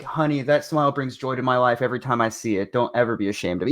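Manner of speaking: sweet reassurance. Retold or imitated. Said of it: "something sweet".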